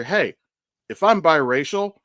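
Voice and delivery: ordinary talk, nothing marked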